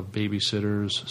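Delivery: ordinary talk, nothing marked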